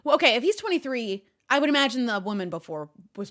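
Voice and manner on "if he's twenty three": normal talking voice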